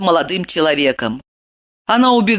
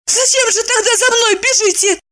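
breathless shout. Acted. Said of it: "задыхаясь, выкрикнула она".

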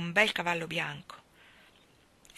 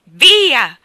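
furious; 2 semitones higher, 15 dB louder, slower than usual. A narrator's reading. Father raging at his daughter to get off him. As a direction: bellow her away. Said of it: "disse Tulliver rabbiosamente".

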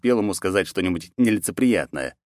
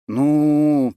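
a long, stretched, high "Ну"; stalling on one long syllable; drawn-out hesitation; 9 semitones higher, 5 dB louder than usual. A narrator's reading. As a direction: draw the word out while thinking. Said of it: "протянул Андросов".

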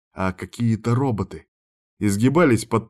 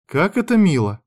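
high sugary fake sweetness; sweet; 8 semitones higher, 4 dB louder than usual. A narrator's reading. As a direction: gush it, louder and higher. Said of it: "улыбнулась сестрёнка".

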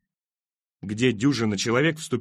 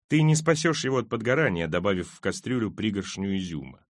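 ordinary delivery, nothing marked

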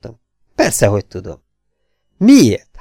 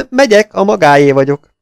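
laughing agreement, higher and louder; gleeful laugh on Megyek; 5 semitones higher, 4 dB louder than usual. Acted. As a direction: burst out laughing as you agree. Agrees to chess delightedly.

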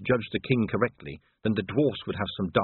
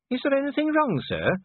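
high-pitched, polite query to a superior; high voice for an anxious subordinate; anxious junior officer. A narrator's reading. Cheery asks Vimes what is wrong, high anxious voice.

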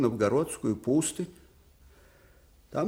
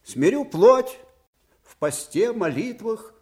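fervent vow; 7 semitones higher, 6 dB louder than usual. Acted. Suvorov defiantly insists on monastery.

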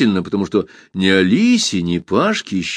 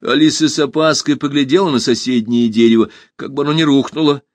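ordinary delivery, nothing marked

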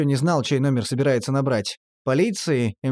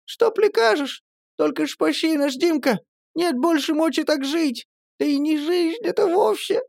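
very high, rushed pleading; the pale girl begs Dimka, very high and rushed; ardent pleading patter. A narrator's reading. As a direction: beg him hotly and fast. Girl keeps pleading desperately.

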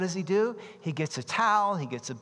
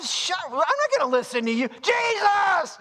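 defiant shout; 13 semitones higher than usual. Retold or imitated. Crying all the louder.

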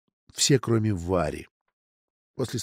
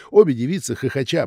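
ordinary delivery

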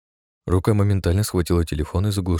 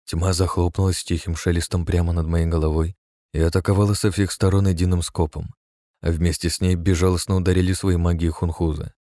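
ordinary delivery

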